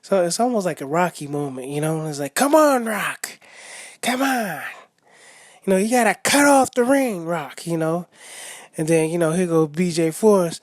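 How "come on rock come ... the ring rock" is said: imitating the father, pitched up and loud; coach yelling. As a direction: yell corner instructions like a trainer.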